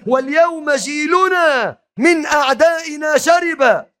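building from plain to high shouted; impassioned poem recital. Real, not acted. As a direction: declaim the verse, climbing to a cry. (إلقاء شعر حماسي يتصاعد إلى صراخ)

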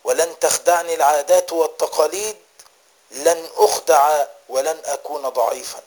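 preaching with firm, driving emphasis; forceful exhortation. Real, not acted. Preacher urges listeners to resolve on repentance, forceful exhortation.

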